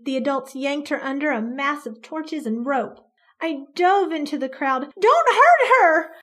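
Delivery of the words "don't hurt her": desperate cry. Acted. Child begging mob to stop.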